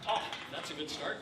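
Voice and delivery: usual speaking voice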